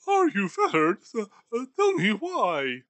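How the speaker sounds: put-on a nervous old skinflint questioning a ghost; Scrooge voice. Scrooge answers Marley's ghost.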